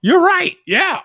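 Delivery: high, loud excited agreeing; thrilled agreement. Retold or imitated. Host acting out delighted agreement with friend, high and excited. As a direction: agree at the top of the voice in mock glee. Reliving shared ogling with friend.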